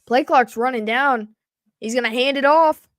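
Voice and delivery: excited touchdown call, pitched high; sportscaster riding the run into the end zone; live play-by-play. Acted. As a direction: build from steady setup into a high, quick, loud call.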